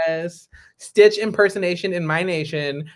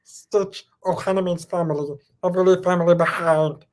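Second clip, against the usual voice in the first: Stitch impression. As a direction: croak it like a small alien. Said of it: "I can also do Stitch".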